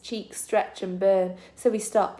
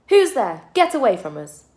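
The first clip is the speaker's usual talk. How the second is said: put-on high, loud startled child's cry; frightened shout; 4 semitones higher, 7 dB louder than usual. A scared girl shouting at the stranger in the dark, high and loud. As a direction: yell it out in fear from the dark.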